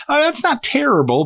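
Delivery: high, surprised aside; mock surprise. Friend reacting to the boxer's pay, high surprised voice. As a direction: pitch the oh way up in disbelief. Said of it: "I was like".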